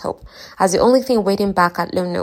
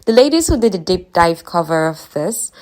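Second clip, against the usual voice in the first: ordinary talk, nothing marked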